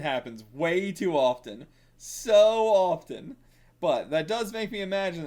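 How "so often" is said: exasperated drawl. Real, not acted. Exasperated about volume covers.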